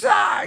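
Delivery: sudden high, loud scream of fright; startled movie scream. Retold or imitated. Retelling Home Alone kid fleeing the pigeon lady, startled scream. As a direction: mimic a kid's terrified yelp. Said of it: "he, like".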